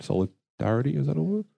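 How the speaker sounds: high, questioning check; doubting whether the word exists; wondering if solidarity is real, then relieved; unsure of the word. Real, not acted. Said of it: "That's not a word".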